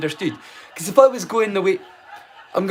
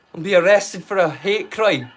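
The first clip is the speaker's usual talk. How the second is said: ordinary talk, nothing marked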